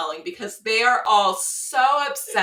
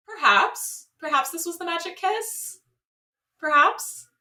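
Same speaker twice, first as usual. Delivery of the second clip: high, rising, hopeful musing; mock hopeful; 8 semitones higher than usual. Viewer musing over the date's kiss, mock hopeful. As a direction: wonder aloud in a high, tentative lilt.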